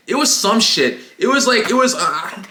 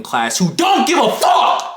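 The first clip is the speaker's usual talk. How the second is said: exasperated outburst; 3 semitones higher than usual. Retold or imitated. Inner reaction to classmate showing off, exasperated outburst. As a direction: grumble bursting into a high yell.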